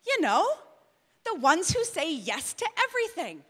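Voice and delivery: usual speaking voice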